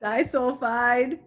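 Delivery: slow, drawn-out prompt. Shown as a demonstration. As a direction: drag out "Disulfide" to cue the rest. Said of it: "Finish it".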